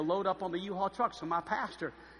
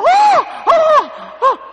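very high, loud mock shriek; hammed-up yelps of panic; freaked out; 20 dB louder, much slower than usual. Pastor freaking out at a kiss, mock panicked shrieks. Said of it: "He freaked out".

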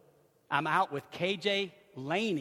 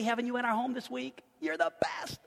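normal talking voice

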